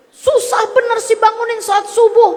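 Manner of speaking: fast, high, scolding nag; wife scolding the husband who won't wake for dawn prayer, high and fast; nagging wife. Retold or imitated. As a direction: snap at the sleeping husband. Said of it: "kamu kata-katain".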